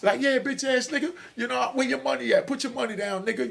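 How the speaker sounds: shooting dice with his crew, playful high trash talk; trash talk over dice. Retold or imitated. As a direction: rib your buddies over a dice game. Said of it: "the way me and my circle used to talk".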